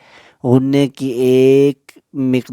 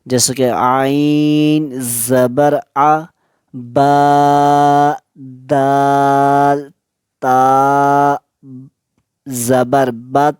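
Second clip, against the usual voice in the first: drawn-out spelling of आइन, बा, दाल, ता; slow qaida spelling; much slower than usual. Shown as a demonstration. Teacher spelling the word for students, slow chant. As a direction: spell every letter slowly and evenly. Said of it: "ये कि रवां में इसको नहीं पढ़ेंगे".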